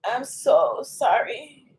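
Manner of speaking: slow, soft tearful apology; spent, weepy apology; crying. Real, not acted. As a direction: drag the words out quietly through real tears. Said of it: "I am not the one to cry like that".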